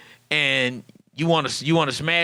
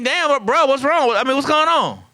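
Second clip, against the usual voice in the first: much higher, louder, puzzled "what's wrong?"; mock-bewildered buddy; baffled friend; 9 semitones higher, 4 dB louder than usual. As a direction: caught off guard, demanding to know.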